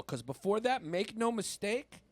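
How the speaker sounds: normal talking voice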